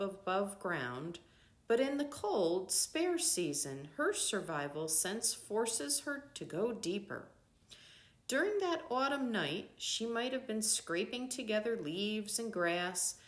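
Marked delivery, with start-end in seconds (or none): none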